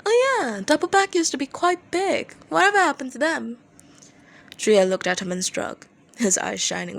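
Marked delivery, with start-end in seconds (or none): 0.0-3.6 very casual. A narrator's reading. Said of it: "Dazai said very casually".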